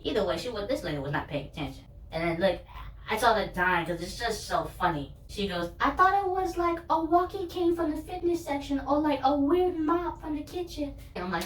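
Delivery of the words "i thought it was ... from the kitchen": much higher, flustered clerk voice; mock flustered explanation, voice way up; flustered cashier. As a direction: squeak out the excuse like the embarrassed cashier. Cashier explains her mistake.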